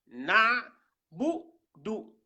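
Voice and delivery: NA held loud, then BOU and DOU set apart; syllable by syllable. Shown as a demonstration. Teacher sounding out the word for learners, syllable by syllable.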